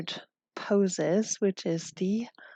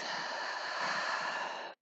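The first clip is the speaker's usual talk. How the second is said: long, audible breath in through the nose; big nose inhale; 9 dB quieter than usual. Shown as a demonstration. Yoga teacher showing the class a breath, big nose inhale. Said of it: "Big inhale through the nose".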